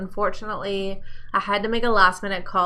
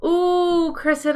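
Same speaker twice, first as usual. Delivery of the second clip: delighted ooh; 8 semitones higher, 3 dB louder, much slower than usual. Real, not acted. Reacting to viewer comment.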